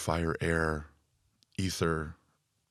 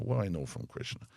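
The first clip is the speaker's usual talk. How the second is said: put-on quick, light New York accent; 4 dB quieter, faster than usual. Quoting a New York saying about Krishna, mild New York accent. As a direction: toss it off like a New Yorker. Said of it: "like they say in New York".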